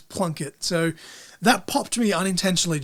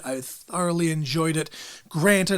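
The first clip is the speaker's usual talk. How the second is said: normal talking voice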